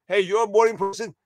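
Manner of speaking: ordinary delivery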